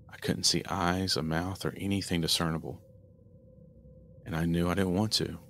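ordinary delivery

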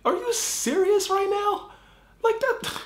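real shock at what he just saw; can't believe his ears; shocked outrage. Real, not acted. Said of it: "my bear is pissed off right now".

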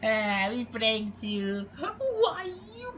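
shrill high cartoon voice; squeaky outrage. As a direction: squeal at being pranked. Pranked character squeals.

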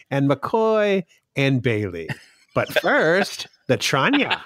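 drawn-out impersonation with swooping pitch; Baylock. Retold or imitated. Fan showing co-host an old TV imitation, playful Baylock impersonation. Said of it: "I first started imitating Baylock going".

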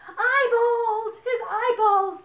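high, loud wailing story panic; horrified. A narrator's reading. Bibi mistakes onions for his eyeballs, horrified. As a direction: wail it in hammed-up horror.

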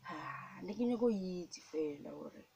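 ordinary delivery

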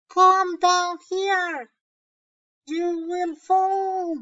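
loud, high, slow call to a friend on the rocks; yelled warning. Acted. Boy yelling up at his friend on the rocks, loud and high. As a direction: shout the warning up the cliff, drawn out. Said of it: "yelled, grita".

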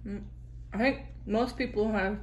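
chewing between words; talking after a bite; mouth partly full. Real, not acted. Seen 2: Bites green tomato at -4; jaw and lips still chewing early on.

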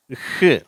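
ஹ் as a lone breathed h with a short vowel; sound of H. Shown as a demonstration. Teacher giving the sound of H, breathed h. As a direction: sound out ஹ் by itself. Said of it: "ஹெச்".

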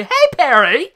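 loud, high cheerful call of a name; mock bubbly hello across the hall; hammed-up greeting call. Girl calling out to Perry, mock bubbly shout. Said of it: "That is her voice".

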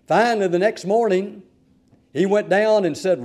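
ordinary delivery

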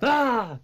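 sudden high yelp to scare; startling boo. Pretend. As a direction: jump out and yell ah. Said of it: "Did I scare you?".

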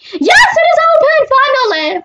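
thrilled cry of relief; excited shout. Real, not acted. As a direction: yell it out in relief and triumph. House finally open in game.

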